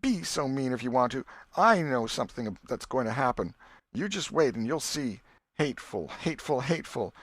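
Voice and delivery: put-on high, sharp, spiteful girl's voice; Becky flinging hateful at Tom before storming out; angry schoolgirl. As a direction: stamp the words out like a furious little girl. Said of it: "she stamped her little foot and said".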